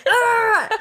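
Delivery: very loud, high mock roar; imitated mad roar. Repeating the punched man's noise for friends, mock roar. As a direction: bellow it hammed-up, nearly laughing. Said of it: "he just got mad".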